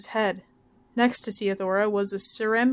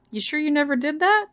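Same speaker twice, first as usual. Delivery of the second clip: higher, rising question of disbelief; mildly surprised; 6 semitones higher than usual. A narrator's reading. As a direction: ask with a touch of surprise. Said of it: "He seemed surprised".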